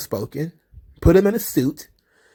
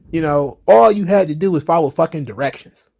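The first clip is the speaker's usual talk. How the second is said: normal talking voice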